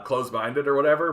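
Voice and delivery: normal talking voice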